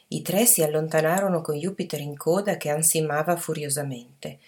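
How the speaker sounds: plain reading voice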